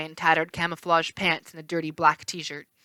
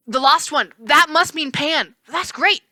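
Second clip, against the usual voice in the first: much higher, louder, thrilled; excited; 8 semitones higher, 7 dB louder, slower than usual. A narrator's reading. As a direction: burst out with joy. Grover excited.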